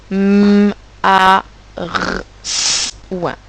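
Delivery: "M, A, R, S, ouin" as drawn-out separate sounds; hummed M, rolled-out R, hissed S, not letter names; sounding out. Shown as a demonstration. Teacher helping those who need it, "M, A, R, S, ouin" sound by sound. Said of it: "j'aide pour la première".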